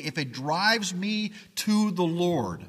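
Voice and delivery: ordinary talk, nothing marked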